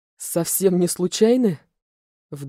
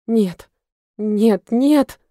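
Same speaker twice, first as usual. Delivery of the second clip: loud, rising desperate protest; desperate refusal; 4 semitones higher, 7 dB louder, much slower than usual. A narrator's reading. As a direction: refuse in despair, building to a cry. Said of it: "повторяла я в отчаянии". (отчаянно, всё громче)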